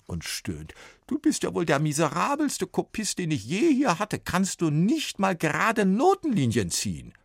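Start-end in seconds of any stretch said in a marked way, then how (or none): 1.1-7.1 higher, indignant complaint; exasperated copyist. A narrator's reading. Copyist despairs at Sven, indignant. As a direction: despair at the crooked lines. Copyist groans over lines.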